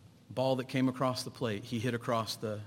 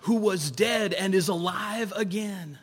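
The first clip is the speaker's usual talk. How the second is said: joyful father; 8 semitones higher, 4 dB louder than usual. Acted. Prodigal's father welcoming son home.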